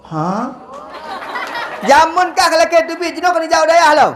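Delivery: high laughing disbelief; laughing high mock reaction. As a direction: squeal it out laughing, mocking. (suara tinggi sambil tertawa mengejek)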